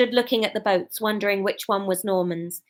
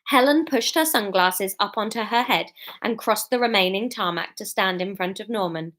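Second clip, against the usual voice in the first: ordinary delivery, nothing marked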